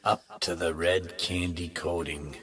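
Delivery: hushed reveal. A narrator's reading. Narrator reveals the candy picture window, hushed. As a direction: ease down low and draw the words out.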